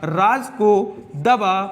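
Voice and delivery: each word spaced out slowly for the class; slow dictation. Shown as a demonstration. Teacher dictating to students, slow and deliberate. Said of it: "हमने लिखा".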